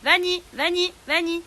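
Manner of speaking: "Vanny, Vanny, Vanny." repeated, high and loud; repeating a pet's name too often; wrong way to call. Shown as a demonstration. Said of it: "vous ne répétez surtout pas son prénom".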